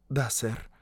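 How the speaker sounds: soft, timid woman's voice; very quiet. A narrator's reading. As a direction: answer barely audibly. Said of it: "Англичанка говорила очень тихо". (очень тихо, робко)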